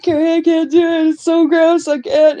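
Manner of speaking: high-pitched mock-tearful pleading; imitating a whiny, crying complaint; retold tearful whine. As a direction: plead with a friend as if in tears. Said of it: "like, in tears".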